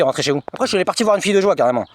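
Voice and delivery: ordinary delivery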